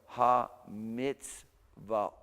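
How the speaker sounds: ha mitzvot slow, the o sound clear and open; ha mitzvot with an open, unburied o; said differently. Shown as a demonstration. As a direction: pull ha mitzvot apart slowly. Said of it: "if I said it a little bit differently".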